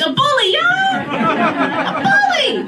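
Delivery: high, drawn-out mock protest; high insistence. Comic insisting to the crowd her daughter is just a bully, high and slow. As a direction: squeal the correction slowly.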